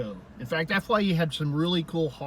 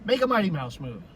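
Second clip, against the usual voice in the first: usual speaking voice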